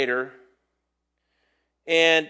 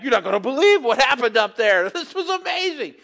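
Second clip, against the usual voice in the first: higher with a laugh breaking in; disciples bragging; 6 semitones higher, 4 dB louder than usual. Retold or imitated. Disciples back from the mountain telling others, excited. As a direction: gush about what you saw up there. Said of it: "They probably came back".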